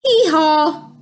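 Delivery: storybook donkey bray; playful hee-haw. A narrator's reading. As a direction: imitate a donkey for children. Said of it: "goes the donkey".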